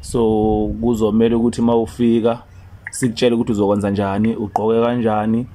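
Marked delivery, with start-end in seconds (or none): none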